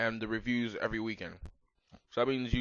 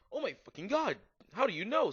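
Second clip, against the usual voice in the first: gasping; 10 semitones higher than usual. Acted. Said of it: "Satan gasped".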